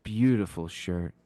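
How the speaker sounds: low, soft compliment; gentle, hushed acted voice; soothing voice. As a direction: soothe him quietly from out of nowhere. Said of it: "he heard a soothing voice say".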